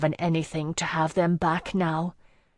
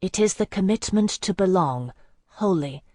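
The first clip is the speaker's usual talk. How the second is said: plain reading voice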